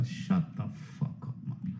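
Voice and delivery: slow, hushed mock put-down; barely audible acted retort; whispered retort. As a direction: whisper it slowly, drawing out the dismissal. Muttered retort to fawning commenters.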